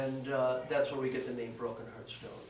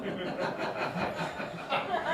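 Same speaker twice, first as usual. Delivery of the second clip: sly laugh; 5 semitones higher than usual. Real, not acted. Teller hints at how he got the stone, chuckling. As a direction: let a knowing laugh slip out. Laughs about secret method.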